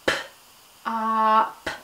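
"P, ah, p" each sound held apart; stretched sounds. Shown as a demonstration. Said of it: "One more time".

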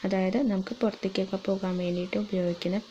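muffled behind cloth mask; speaking with mouth covered; through a face mask. Real, not acted.

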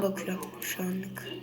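whispering. Real, not acted. Whispered aside while playing.

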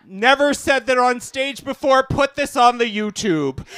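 ordinary talk

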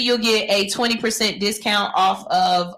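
ordinary delivery

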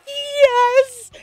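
loud, high cry of victory; triumphant shout. Retold or imitated. Streamer acts out finally getting the catalog, triumphant shout. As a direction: yell it like you finally won.

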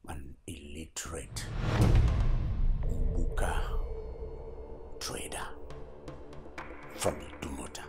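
scornful, breathy put-down; a father sneering at his daughter's uneducated suitor; contemptuous whisper. Acted. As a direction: hiss the insult, barely voiced.

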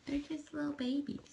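sweet high pet voice. Real, not acted. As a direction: coo it like talking to a newborn kitten. Cooing at kittens.

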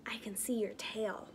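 soft unvoiced whisper. Lion whispering to the child hiding in the bushes. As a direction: whisper it so nobody hears.

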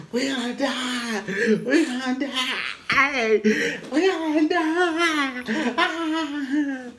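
drawn-out falsetto mock wail; high-pitched screaming man. Tough guy drags his friend into it, high mock wail. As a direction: wail it high and stretched, hammed-up panic. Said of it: "He has a real high voice".

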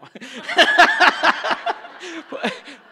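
loud, high burst of laughing; laughter. Real, not acted. Preacher after joking about the live feed, big laugh. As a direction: break up laughing at your own joke.

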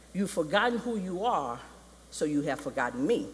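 solemn, measured rebuke; Mufasa. Retold or imitated. Father rebukes Simba from the sky, solemn. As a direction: intone each phrase with weight. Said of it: "Mufasa comes out of the sky".

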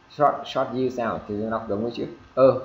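ordinary talk

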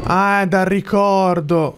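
normal talking voice

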